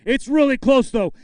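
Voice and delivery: normal talking voice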